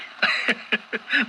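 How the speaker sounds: breathy unvoiced chuckle; teasing laugh. Coworker [name] laughs at Bernie's stubborn loyalty, breathy teasing chuckle. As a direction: laugh it off at your friend.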